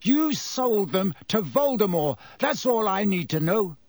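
put-on bitter, cold accusing teen; angry accusation. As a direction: spit out the accusation.

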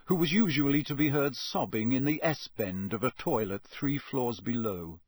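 ordinary delivery, nothing marked

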